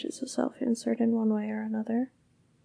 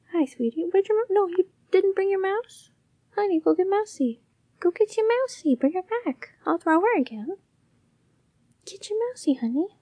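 pet talk; 8 semitones higher, 5 dB louder than usual. Real, not acted. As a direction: coo sweetly at the cat. Baby talk to her cat.

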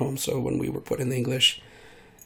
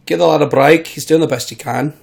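a London geezer begging for slack; cockney; 3 semitones higher, 8 dB louder than usual. Shown as a demonstration. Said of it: "a bit more cockney there".

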